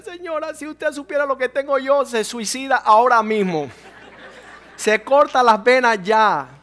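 loud, high, laughing jab at her; half-joking shout that she has it easy; loud mocking retort. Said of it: "Y yo decía".

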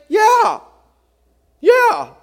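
loud, eager yeah yeah; a hammed-up loud yes; mock shouted agreement. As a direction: shout it like a swept-up crowd. Voicing sentimental agreement, shouting.

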